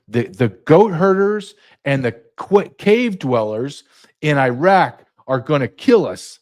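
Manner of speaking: usual speaking voice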